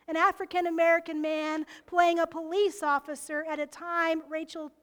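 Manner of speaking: ordinary talk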